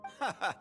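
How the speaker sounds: short, high laugh; amused, dismissive chuckle; scoffing laugh. Acted. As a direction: laugh off the boast. Scoffing at a child's boast.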